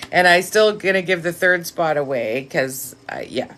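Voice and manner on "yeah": ordinary talk, nothing marked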